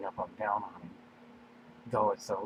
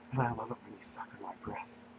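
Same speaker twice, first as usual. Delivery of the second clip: soft unvoiced whisper; 4 dB quieter than usual. Daenerys whispering to William in bed. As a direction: let it come out as breath only. Character speaks during love scene.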